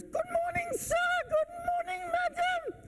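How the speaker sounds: very high, ringing doorman greeting; over-feminine doorman impression. Doorman greeting guests across the lobby, high-pitched mimicry. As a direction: sing out a greeting in falsetto. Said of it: "A little bit more masculine than that".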